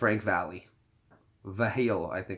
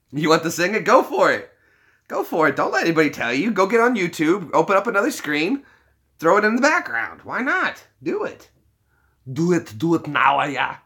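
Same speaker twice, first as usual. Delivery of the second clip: excited encouragement; 7 semitones higher, 5 dB louder than usual. Real, not acted. Urging a viewer to sing.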